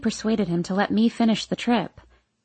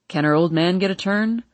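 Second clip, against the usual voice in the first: father's voice; 3 semitones lower than usual. A narrator's reading. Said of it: "I hear my father say".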